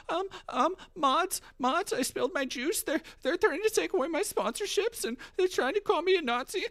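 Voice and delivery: put-on high, babyish whine to the mods; mocking whiny streamer. Mocking a streamer begging her mods, high whine. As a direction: play a helpless little streamer tattling. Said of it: "And she's like".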